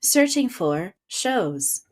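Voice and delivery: robot voice. Acted. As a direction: speak like Alexa. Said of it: "I really like your robot voice".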